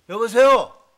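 a loud, impatient hello into the phone; fed-up shout into the receiver. Acted. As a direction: bark it down a dead line. Repeated answering a silent call.

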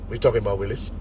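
low, softened catchphrase delivery; catchphrase imitation. Reacting to big talkers who ran from gunfire, low catchphrase imitation. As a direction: drop deep and quiet for the quoted comeback. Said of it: "and you be like".

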